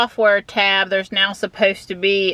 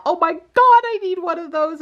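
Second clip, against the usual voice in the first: excited; 11 semitones higher than usual. Retold or imitated. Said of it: "chatting with my Tesla friends".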